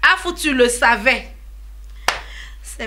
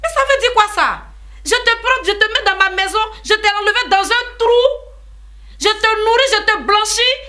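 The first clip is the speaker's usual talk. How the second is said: outraged rant; 10 semitones higher than usual. Acted. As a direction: pile up every favour you did her, furious. Aunt rants at ungrateful sister.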